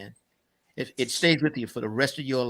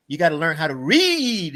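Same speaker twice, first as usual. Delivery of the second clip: louder, higher, last word stretched long; grandfather's drawn-out preaching; 4 semitones higher, 6 dB louder than usual. Retold or imitated. Grandfather urging his grandson to get schooling, drawn-out exhortation. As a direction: insist like a grandfather, stretching READ. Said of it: "That's what he talked".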